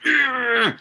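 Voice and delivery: high, loud forced grunt of fake passion; mock overacting grunt; strained overacted grunt. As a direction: push out a hammy, red-faced ugh. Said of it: "turning red and going".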